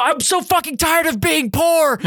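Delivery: high, rushed, whiny outburst; hammed-up wail of frustration, fast and high; exasperated meltdown. Driver venting to his partner about being broke, exasperated meltdown.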